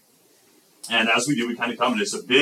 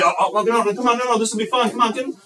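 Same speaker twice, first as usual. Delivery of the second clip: very high, louder eager begging; pleading kids; 12 semitones higher, 3 dB louder, faster than usual. Acted. Kids promising it will be fun, high eager pleading. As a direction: coax urgently in a high child-like pitch.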